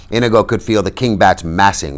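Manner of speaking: plain reading voice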